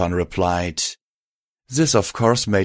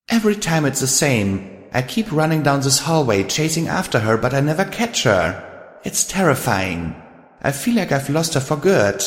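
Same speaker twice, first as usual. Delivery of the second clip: higher-pitched, anguished confession; troubled, pitched-up character voice; distressed Twilight; 7 semitones higher than usual. Acted. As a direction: confide a recurring nightmare, close to tears. Twilight tells her nightmare, near sobbing.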